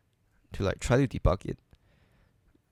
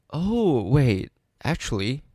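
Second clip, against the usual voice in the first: sudden surprise; 4 semitones higher, 5 dB louder, slower than usual. Real, not acted. Sudden realization while coding.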